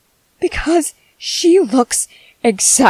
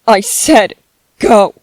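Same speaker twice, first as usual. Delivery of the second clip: loud, cold command to leave; loud final order; 5 semitones lower, 6 dB louder than usual. Acted. Telling the ghost to leave, loud and final. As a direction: bark the last word like a door slamming.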